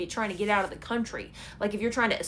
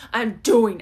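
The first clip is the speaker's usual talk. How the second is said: determined; 9 dB louder than usual. Pretend. Imagined determined inner voice.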